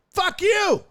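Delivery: high laughing outburst; laughing shout. Real, not acted. Retelling funny tweet.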